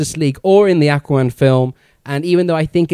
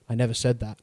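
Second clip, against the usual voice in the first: dropped soft, almost under the breath; quiet admission; 5 semitones lower, 10 dB quieter, faster than usual. Retold or imitated. Aquaman quietly telling Flash he cares, soft and low. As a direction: let it slip out quietly, a touch gruff.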